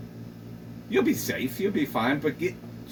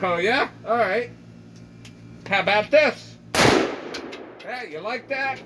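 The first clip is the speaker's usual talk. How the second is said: higher, rising mock taunt, lightly voiced; taunting neighbor; 5 semitones higher than usual. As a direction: goad him, pitched up. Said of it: "And she was like".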